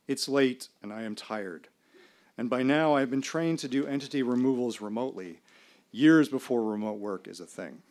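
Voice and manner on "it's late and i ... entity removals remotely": ordinary delivery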